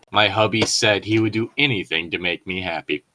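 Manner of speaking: ordinary talk, nothing marked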